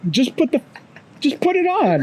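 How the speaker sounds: high-pitched exasperated urging; frustrated. Retold or imitated. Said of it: "I'm getting frustrated".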